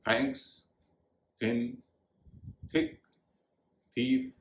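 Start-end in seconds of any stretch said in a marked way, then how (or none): none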